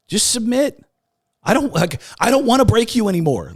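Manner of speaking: high, loud, emphatic urging; insistent pleading. Retold or imitated. Inner voice urging him to give in, high insistent plea. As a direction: plead hard, pressing each phrase home.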